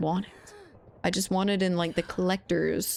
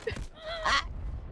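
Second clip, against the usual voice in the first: high squeal laugh; 7 dB quieter than usual. Real, not acted. Laughing at own cheeky request.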